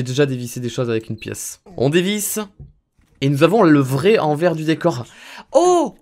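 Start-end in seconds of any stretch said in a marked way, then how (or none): 5.5-6.0 high, loud "Oh!"; sudden cry of amazement; surprised gasp. Real, not acted. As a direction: exclaim at a discovery. Surprised at game discovery.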